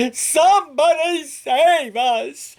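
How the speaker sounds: high, slow, panicky cry for help; frightened yell. Acted. Terrified dogs yelling for help, high and panicky. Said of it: "The dogs yelled".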